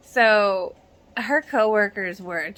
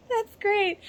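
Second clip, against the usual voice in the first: laughing; 10 semitones higher than usual. Real, not acted. Laughing at a cheesy pickup line.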